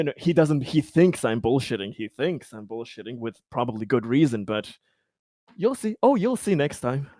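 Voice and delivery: normal talking voice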